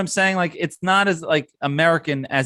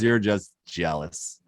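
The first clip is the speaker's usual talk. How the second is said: taunting; 3 semitones lower, 4 dB quieter, slower than usual. Acted. Mocking rival fans.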